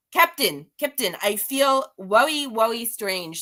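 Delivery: hammed-up, slow-drawn Russian accent; terrible Russian accent. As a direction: put on a bad Russian accent. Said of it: "I do a terrible Russian accent".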